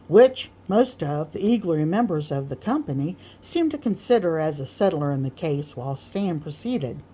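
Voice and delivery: usual speaking voice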